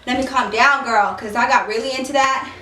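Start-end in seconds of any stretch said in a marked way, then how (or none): none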